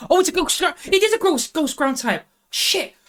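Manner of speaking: high, startled outburst of disbelief; real surprise, voice jumping up high; shocked realisation. Real, not acted. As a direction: it suddenly dawns on you and you yelp. Just found the answer.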